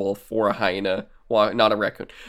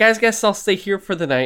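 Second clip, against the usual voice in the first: higher, mock carefree; lightly hammed oblivious hero; breezy Hutter; 8 semitones higher, 4 dB louder than usual. Hutter settles in despite werewolves, higher and breezy. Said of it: "is just like".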